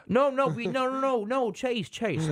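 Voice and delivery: high-pitched, half-attempted team-boss voice; attempted Jerry impression. Cowboys boss objects to criticism of a trade, attempted impression. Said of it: "I can't do a Jerry accent".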